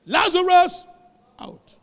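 loud high call to the dead man. Retold or imitated. Preacher as Jesus calling Lazarus from the tomb, loud shout.

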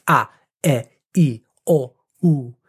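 pure Italian "A, E, I, O, U", said ah, eh, ee, oh, oo; each vowel of "A, E, I, O, U" separate and fully formed, with pauses; over-articulated vowels. Shown as a demonstration. Teacher modelling Italian vowels "A, E, I, O, U", each really articulated. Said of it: "really articulate them".

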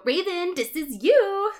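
high, excited fangirl aside; author's note voice. Acted. The author tells friend Raven she's in it, high-voiced. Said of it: "author's note".

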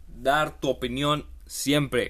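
slow booming reveal; big announcement. Acted. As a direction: announce it like a headline. Announcing the final habit.

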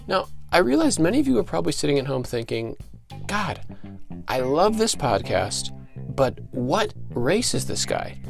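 usual speaking voice

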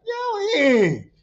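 loud, high, delighted call to a friend; happy greeting. Retold or imitated. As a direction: holler a joyful hello. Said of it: "I was so happy to see her".

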